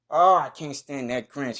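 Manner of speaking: ordinary talk, nothing marked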